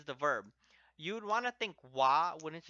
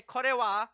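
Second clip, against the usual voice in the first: "Kore wa" pitched higher and louder, pointing; emphasized kore wa; 8 semitones higher, 9 dB louder than usual. Shown as a demonstration. Teacher shows how kore wa highlights the pen, emphatic. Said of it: "like that".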